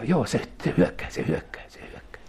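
fading into a hushed whisper; mock whisper. Preacher mocking the demon's threat, fading whisper. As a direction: let it die away to a breath.

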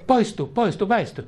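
high, sharp command to depart; commanding rebuke. Acted. Preacher commanding the enemy's objections to leave, high commanding rebuke. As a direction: cast it out with authority.

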